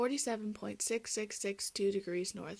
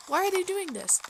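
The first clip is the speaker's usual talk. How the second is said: higher, louder dismayed question; upset disbelief; 6 semitones higher, 6 dB louder than usual. Acted. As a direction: cry out in mild dismay. Caribou upset at loggers destroying home.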